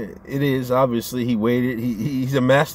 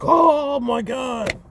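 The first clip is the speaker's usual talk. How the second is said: high, mocking pearl-clutching gasp; 13 semitones higher, much slower than usual. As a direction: fake shock, way up high. And now we're like.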